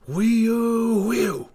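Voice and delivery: high, falling wind-like whoop; long, shrieking "whee-oo"; old snow woman's howl. A narrator's reading. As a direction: howl like a winter gale. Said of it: "came the old snow woman's voice".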